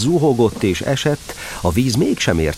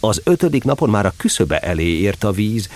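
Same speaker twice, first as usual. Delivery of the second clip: ordinary delivery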